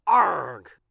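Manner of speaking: loud, gruff pirate arg; pirate growl. A narrator's reading. Pirate Pete grumbling at the librarian, loud arg.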